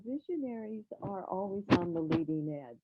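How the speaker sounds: far from the microphone; speaking away from the mic; distant voice. Real, not acted. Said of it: "[name], can you come closer to the mic?".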